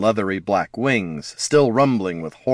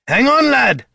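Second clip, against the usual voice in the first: high, loud rallying cry; full-voiced alarmed call; urgent shout; 6 dB louder than usual. A narrator's reading. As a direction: shout it to someone under attack. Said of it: "Gorm shouted".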